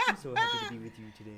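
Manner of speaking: very high, fast, gushing church lady voice; imitating Marquise. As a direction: gush like a doting Southern auntie. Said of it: "he'd go like".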